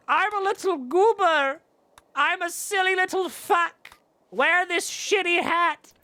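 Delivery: high, slow silly goofball voice; Festi. Retold or imitated. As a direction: play it like a giddy little goober. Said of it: "Festi's like".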